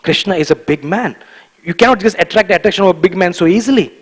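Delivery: usual speaking voice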